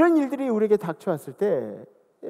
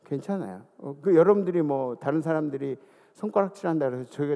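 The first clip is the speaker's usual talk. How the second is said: usual speaking voice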